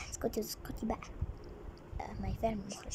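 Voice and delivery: hushed aside; whisper. Real, not acted. Hushing the director off camera, whispered. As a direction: whisper to keep her quiet.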